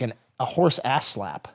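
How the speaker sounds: normal talking voice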